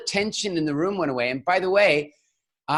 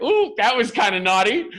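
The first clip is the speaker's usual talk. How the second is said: a touch of coy teasing; teasing audience; 4 semitones higher than usual. Retold or imitated. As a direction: play the crowd's naughty ooh. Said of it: "like, like".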